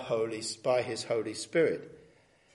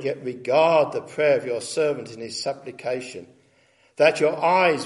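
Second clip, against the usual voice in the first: scripture reading; 3 semitones higher, 5 dB louder than usual. Acted. Reads Solomon's prayer aloud.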